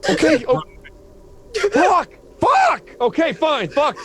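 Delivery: high, loud swearing bursts; panicked shouting. Acted. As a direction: yell it in panic. Driver panics, swears aloud.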